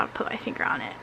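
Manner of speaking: fast whispered mumble. Real, not acted. Vlogger mumbling to herself while hunting for words, in a quick whisper. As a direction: rush it under your breath. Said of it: "mumbled self-correction".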